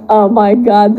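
ordinary talk, nothing marked

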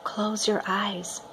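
mother softly telling her son to close his eyes; soft whisper. Acted. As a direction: hush the child toward sleep, slowly. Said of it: "Mama whispers".

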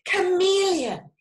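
high, loud excited call; excited sloth. A narrator's reading. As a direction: call out eagerly to a friend. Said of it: "Sloth was excited".

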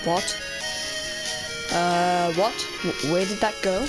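ordinary talk, nothing marked

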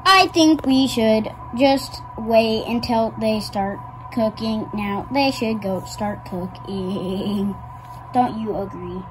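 measured low character voice; slow, deliberate character. Acted. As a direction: suggest it slowly and ponderously.

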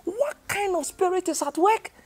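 high, slow, loud pulpit plea; pleading preaching. Real, not acted. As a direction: cry out an urgent appeal to the congregation. Pleads with the congregation.

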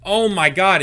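loud, high burst of real astonishment; amazed outburst. Real, not acted. Reactor catching the U two wordplay, loudly astonished. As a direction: exclaim it as the punchline clicks. Wordplay has just clicked for him.

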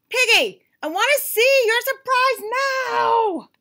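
high, loud insistent demand; demanding shout. Acted. Gerald demands to see Piggy's surprise, loud high. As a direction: insist like a cranky child.

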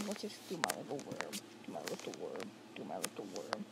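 deep goofy chant to the worm; low silly worm voice. Pretend. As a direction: drop the voice deep and croon it. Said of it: "So that's how it always works".

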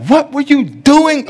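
high, slow upset dad demanding answers; a father's alarmed scolding question. Retold or imitated. Father on the phone with daughter, upset and high-pitched. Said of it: "One, I'm upset because I'm like".

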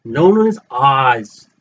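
high, showman's presentation with long pauses; grand announcer reveal. Acted. Vlogger unveiling his new Oz items to fans, grand announcer voice. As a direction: unveil it like a ringmaster.

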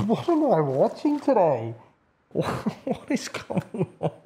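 high, slow, incredulous laughing question; laughing disbelief. Real, not acted. Player watching a cheater, laughing in disbelief.

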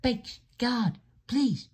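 very high pleading voice; urgent character cry. A narrator's reading. Driver as the train nears harbor, urgent.

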